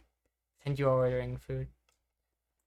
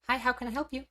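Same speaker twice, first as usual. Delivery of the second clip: much higher bright helpful greeting; customer service voice; 11 semitones higher, faster than usual. Shown as a demonstration. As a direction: greet like a chipper customer service rep, pitched up. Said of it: "Customer service voice".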